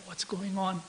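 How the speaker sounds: hushed, puzzled murmur; confused. Retold or imitated. Brahma bewildered by creation, confused. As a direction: say it softly, as if lost.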